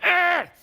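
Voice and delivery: high, squeezed straining groan; demonstrated grunt of holding it all in. Shown as a demonstration. Coach showing a tightly held voice, straining. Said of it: "holding a, a plop of crap".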